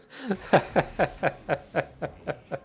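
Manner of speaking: a long high giggle; amused at his own habit; laughing. Real, not acted. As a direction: laugh at yourself.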